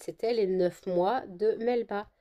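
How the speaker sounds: usual speaking voice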